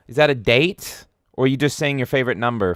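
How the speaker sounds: ordinary talk, nothing marked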